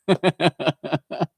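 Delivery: a fond burst of laughter; real laugh. Real, not acted. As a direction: laugh warmly at your giggling son. Son giggling on couch.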